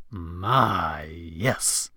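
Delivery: low, drawn-out, appreciative purr; sultry admiration. Acted. Marilyn ogles Jimmy's physique, low and sultry. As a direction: savor each word, low and soft.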